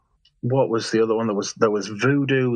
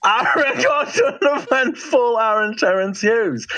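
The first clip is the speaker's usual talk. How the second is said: laughing; 10 semitones higher, slower than usual. Real, not acted. Laughing while mocking a rival.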